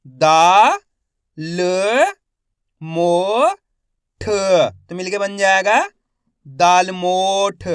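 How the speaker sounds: slow spelling drill, each syllable held with a rising end; stretched syllables for beginning readers; letter-by-letter sounding out. Shown as a demonstration. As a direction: sound out each letter, then blend the word. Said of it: "एक एक अक्षर का उच्चारण करके".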